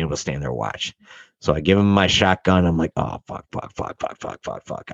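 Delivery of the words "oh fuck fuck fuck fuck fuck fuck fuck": whispered string of curses, getting softer; whispered panic. Retold or imitated. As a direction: panic under the breath, fading to nothing.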